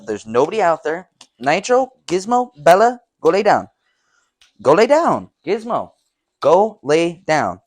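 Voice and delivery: loud, high go lay down; firm calls by name to settle them; commanding the dogs. Real, not acted. Calling Nitro, Gizmo, Bella to lie down, loud commands.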